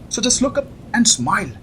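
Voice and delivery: slow, high playful sing-song; mock-soothing tease; teasing. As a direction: coax her mockingly. Teasing her about lightning.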